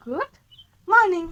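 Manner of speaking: high, loud burst on the word; loud prompt. Real, not acted. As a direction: call it out to get her to repeat. Coaxing toddler to say morning.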